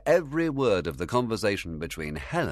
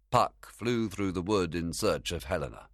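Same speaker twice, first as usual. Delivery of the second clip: ordinary delivery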